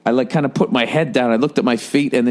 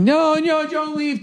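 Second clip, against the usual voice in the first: high-pitched imitation of her begging him to stay; mock pleading girlfriend; slower than usual. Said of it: "she's fucking".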